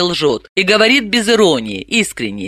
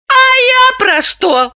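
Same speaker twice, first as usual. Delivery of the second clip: high, slow, eager exclaim with stress on «я»; eager and high-pitched; inspired; 4 dB louder, slower than usual. Acted. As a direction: lit up by the idea. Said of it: "вдохновилась Галина".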